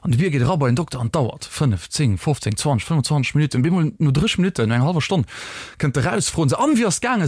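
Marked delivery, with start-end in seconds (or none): none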